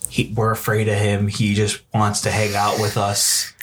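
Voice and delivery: low, slow, eerie ghost voice; mock ghost voice. Ghosts on the voice recorder, low eerie voice. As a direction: drop into a spooky ghost voice.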